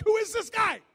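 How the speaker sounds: high loud puzzled crowd voice; mock crowd shout. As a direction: bark it like a suspicious crowd.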